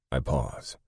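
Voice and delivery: ordinary delivery, nothing marked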